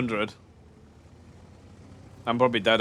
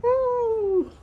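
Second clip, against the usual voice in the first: high excited whoop. Real, not acted. Excited yell during game.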